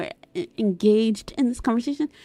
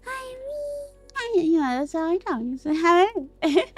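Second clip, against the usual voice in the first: tiny silly voice, pitched up; small and silly voice; 6 semitones higher than usual. Shown as a demonstration. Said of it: "I talk like this sometimes".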